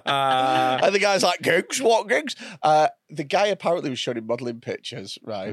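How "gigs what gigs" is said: puzzled imitation. Imitates the stranger's puzzled response.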